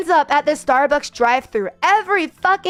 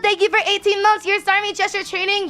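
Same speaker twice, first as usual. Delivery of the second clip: excited; 8 semitones higher than usual. Real, not acted. Streamer greets and thanks chat.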